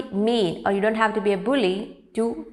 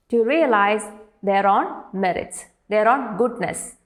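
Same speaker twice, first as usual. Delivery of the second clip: ordinary delivery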